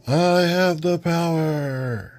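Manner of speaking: high, slow heroic proclamation; He-Man battle cry. Acted. Hero raising his sword, slow triumphant high cry. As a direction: draw out the hero's famous line. He-Man's catchphrase in comic reading.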